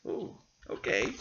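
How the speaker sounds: usual speaking voice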